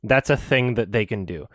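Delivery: ordinary delivery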